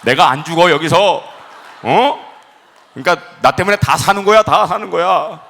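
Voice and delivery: loud, cocky mock declaration; bold proclamation. Preacher reenacting his proclamation to fellow passengers, loud confident boast. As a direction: proclaim it to everyone with total certainty. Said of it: "주변에 보면서 제가 선포한 거예요".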